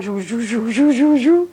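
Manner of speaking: quick run of whooshing noises, climbing at the end; mouthed movie-rewind zhoo noises; rewind sound effect. Shown as a demonstration. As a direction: imitate a film zipping backward. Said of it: "backward with sound".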